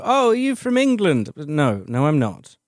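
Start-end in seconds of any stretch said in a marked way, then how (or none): 0.0-1.3 high-pitched imitation of a nosy questioner; mock-surprised high question; curious Australians. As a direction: pipe up high, mimicking people asking. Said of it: "a lot of Australians go".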